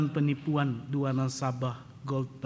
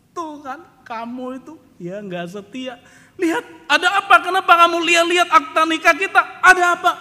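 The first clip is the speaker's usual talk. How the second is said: loud, high tearful accusation; wife shrieking at her husband; crying wife; 14 semitones higher, 14 dB louder than usual. Retold or imitated. Wife confronting husband over the marriage papers, shrill and loud. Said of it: "dia tambah nangis".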